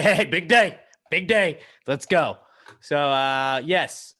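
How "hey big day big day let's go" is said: loud, higher hype-up call; playful rallying shout; mock pep talk. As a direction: psych yourself up for the big day. Acts out his body psyching itself up.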